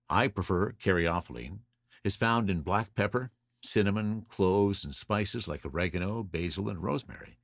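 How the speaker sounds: normal talking voice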